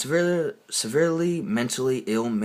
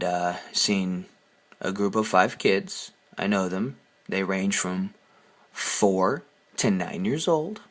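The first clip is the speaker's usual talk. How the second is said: ordinary talk, nothing marked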